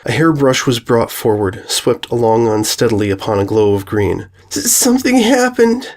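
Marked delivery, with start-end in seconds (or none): 4.5-5.9 high, tearful stammer; a little girl fighting back tears; blubbering. A narrator's reading. Filly showing her sister the brush, blubbering. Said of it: "Sweetie Belle blubbered".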